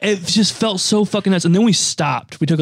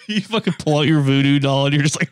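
usual speaking voice

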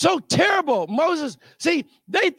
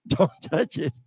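much lower and softer don't touch it, in a different voice; low hushed warning; 7 semitones lower, 6 dB quieter than usual. Retold or imitated. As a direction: growl it quietly under your breath. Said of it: "he said".

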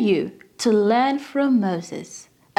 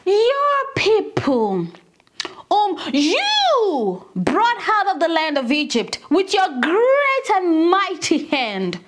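"your" and "you" held long and pitched up; stress on YOUR and YOU; 3 semitones higher, slower than usual. Acted. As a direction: throw it back, dragging out "your", "you" and "great". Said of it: "turning right back to God and saying".

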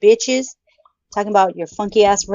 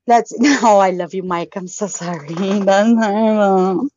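ordinary delivery

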